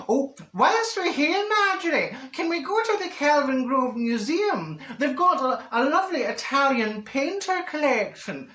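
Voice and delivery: high, slow put-on voice for her pal; Minnie's voice. Marjorie imitating pal Minnie, high and slow. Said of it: "And when she says".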